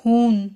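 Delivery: हून with a long stretched ऊ and silent h; long oo in Huhn. Shown as a demonstration. Teacher showing German Huhn with a long vowel. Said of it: "ऊ को long करके बोला गया है".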